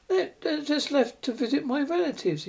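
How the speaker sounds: rueful reply to the worried postmaster; rueful smile. A narrator's reading. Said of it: "he smiled ruefully".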